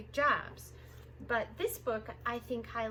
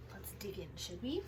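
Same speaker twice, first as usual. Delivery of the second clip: hushed, playful whisper; 4 semitones lower, 7 dB quieter than usual. Acted. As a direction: lean in and whisper the invitation. Playful hushed invitation to listen.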